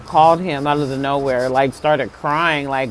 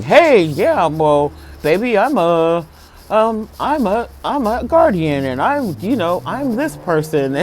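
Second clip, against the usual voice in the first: a hint of a cocky smooth talker, pitched up; half-hearted pickup-line voice; mock smooth-talking guardian; 4 semitones higher than usual. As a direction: lightly play a guy sweet-talking, baby. Mocking how a guardian talks.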